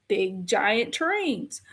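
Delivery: ordinary talk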